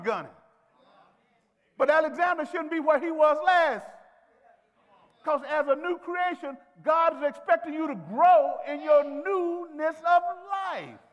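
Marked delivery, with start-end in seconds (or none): 1.8-11.0 loud, high, slow preacher's shout; preaching at full force. Acted. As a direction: drive every word home to the pews. Preaching climax.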